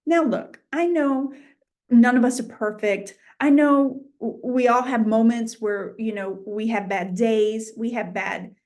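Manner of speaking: ordinary talk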